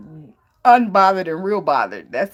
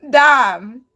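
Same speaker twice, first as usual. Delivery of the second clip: usual speaking voice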